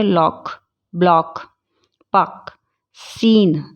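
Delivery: लॉक, ब्लॉक, पक, सीन each with one clean consonant for the spelling pair; लॉक, ब्लॉक, पक, सीन as separate model words; silent C. Shown as a demonstration. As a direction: say लॉक, ब्लॉक, पक, सीन as lok, blok, puk, seen. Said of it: "सी को silent करके क्या बोलेंगे".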